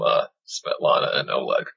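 ordinary talk

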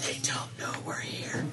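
hushed in-character whisper. Acted. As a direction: whisper it so the goblins can't hear. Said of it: "Okay, I'm gonna whisper".

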